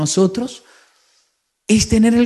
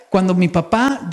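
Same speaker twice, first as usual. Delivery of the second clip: normal talking voice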